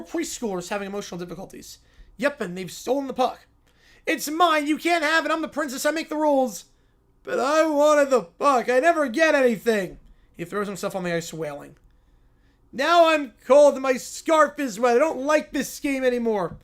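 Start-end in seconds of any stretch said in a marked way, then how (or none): 4.1-10.0 put-on high, loud, whining little kid; whiny kid. Kids fight over the puck. 12.7-16.6 put-on high, loud, whining little kid; whiny kid. Kid sulks on the ice.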